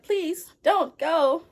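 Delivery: high, hammed-up motherly plea; mock pleading. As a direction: beg like a melodramatic mother. Acted mother begs son to stay.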